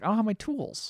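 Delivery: high-pitched mock excuse; making excuses. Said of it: "He's trying to get out of it".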